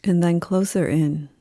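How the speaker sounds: slow, calm yoga nidra voice with long pauses; guided meditation. Acted. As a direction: guide them unhurriedly into rest, leaving space after each phrase.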